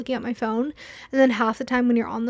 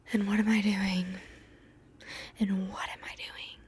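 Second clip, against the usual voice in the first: lost whisper; 4 semitones lower, 8 dB quieter than usual. Retold or imitated.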